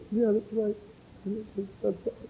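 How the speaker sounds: a broken man quietly owning up; tearful apology. Retold or imitated. Man in tears to the preacher, soft high apology. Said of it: "I saw tears falling out".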